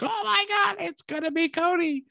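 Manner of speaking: very high squealing fan imitation; mock fan frenzy. The internet guessing the mystery opponent, mock frenzy. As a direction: squeal like a hyped-up crowd online.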